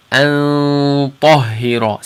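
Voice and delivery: slow "An tahira" with a full heavy ta; heavy ikhfa. Shown as a demonstration. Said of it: "So you hear it".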